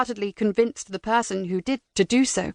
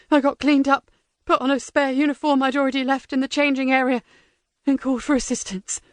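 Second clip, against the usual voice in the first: high, frantic prisoner confessing; desperate captive blurting it out; panicked prisoner; 4 semitones higher than usual. Acted.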